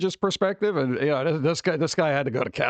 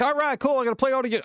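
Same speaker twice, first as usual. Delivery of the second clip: excited gamer; 7 semitones higher, faster than usual. Retold or imitated. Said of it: "and you're like".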